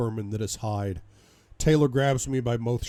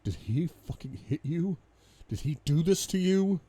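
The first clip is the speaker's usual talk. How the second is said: tag; 6 semitones higher than usual. Acted. Husband sees wife's injured face.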